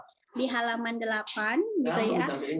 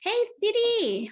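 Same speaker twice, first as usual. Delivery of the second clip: very high, slow, drawn-out yell; yelling hail; 11 semitones higher, 4 dB louder, slower than usual. Shown as a demonstration. Teacher demonstrates the example as shouting, a high yell. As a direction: yell out to get someone's attention. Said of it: "Just like yelling gitu, shouting".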